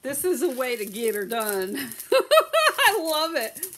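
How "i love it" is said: loud, slow laughing exclamation; laughing delight. Real, not acted. Seller loves how the order got done, laughing. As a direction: burst out laughing, then keep it loud.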